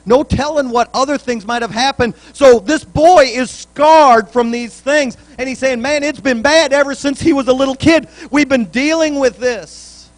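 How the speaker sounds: loud, high preaching build; fervent pulpit preaching; preaching at full force. Acted. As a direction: lift the congregation, loud and rising.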